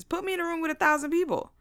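usual speaking voice

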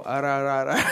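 imitating someone who can't roll the R in "rah"; mock attempt at trilling the R in "rah"; failed rolled R. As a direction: say "rah, rah, rah" with separate untrilled R's. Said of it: "That's what my brother does when he can't roll, he can't roll his R's".

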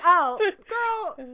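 loud, high, drawn-out comic dismay; mock dismay. Viewer retells spotting the forced accent, loud mock dismay. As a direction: wail it in playful pity.

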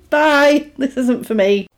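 high, laughing "I'm gonna go"; laughing mock exit.

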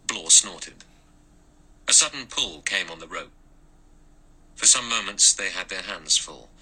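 usual speaking voice

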